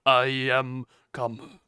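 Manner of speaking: slow, put-on character voice; "I am come" voice. Shown as a demonstration. Streamer replays the requested opening catchphrase, slow put-on voice. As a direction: draw it out in character. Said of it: "say that in your I am come voice".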